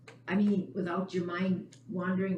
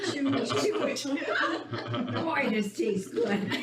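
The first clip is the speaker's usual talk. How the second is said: joking mock eating; 4 semitones higher, much slower than usual. S1 jokes about eating meditation.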